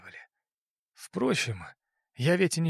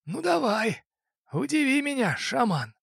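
raised-pitch voice for the fat boss; crime boss voice; 10 semitones higher, 3 dB louder than usual. Acted. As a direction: play the smug boss making an offer. Boss voice again.

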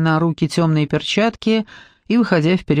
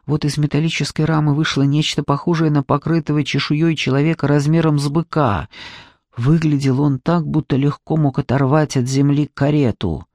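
usual speaking voice

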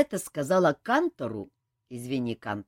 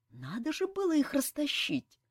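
guilty explanation; 11 semitones higher than usual. A narrator's reading. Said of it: "виновато объяснил командор Фортуната".

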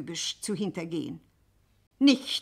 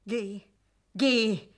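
sharp command; 3 semitones higher, 5 dB louder than usual. Acted. Scuderi sends Madelon away.

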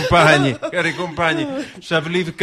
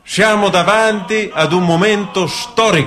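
formal speech; 5 semitones higher than usual. Acted. Political speech to citizens.

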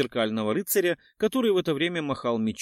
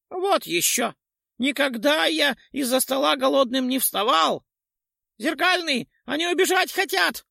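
high, louder ghoul shriek; 11 semitones higher, 4 dB louder than usual. A narrator's reading. Ghoul shrieks to the mirror knight, high and frantic. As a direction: squeal it out to raise the alarm. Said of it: "взвизнул поганец".

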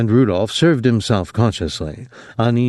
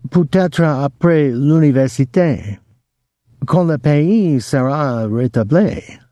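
higher French, mimicking a teacher; Miss Leno; 6 semitones higher than usual. A narrator's reading. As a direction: show off a well-mimicked French accent. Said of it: "He was a good mimic".

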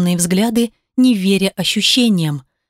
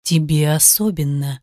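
seductive demon purr; 5 semitones lower than usual. A narrator's reading. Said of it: "вкрадчиво прошептал".

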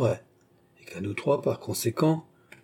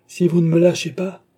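protestation haute et forte; menace criée; 7 semitones higher, 6 dB louder than usual. A narrator's reading. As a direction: menacer en se débattant. Captif qui menace de dénoncer. (menace aiguë de prisonnier révolté)

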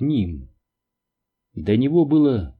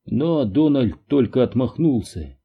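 ordinary delivery, nothing marked

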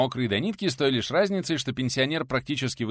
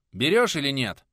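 loud, raised, pressing question; frowning demand; 4 semitones higher, 7 dB louder than usual. A narrator's reading. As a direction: press him for an answer with a frown. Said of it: "насупился преподаватель".

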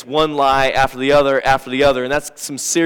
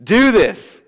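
higher, louder, slow nagging accusation; accusing spouse; 6 semitones higher, 6 dB louder, much slower than usual. Retold or imitated. Pastor acting an accusing spouse mid-fight, nagging. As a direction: hurl it like a fed-up spouse. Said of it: "as we say statements like this".

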